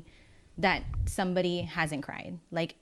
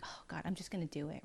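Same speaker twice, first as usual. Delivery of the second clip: fast, much softer whisper with no voice; scared whisper; 13 dB quieter, faster than usual. Retold or imitated. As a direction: whisper it to yourself in fear. Client's scared inner voice.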